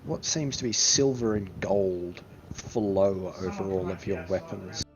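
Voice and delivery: ordinary talk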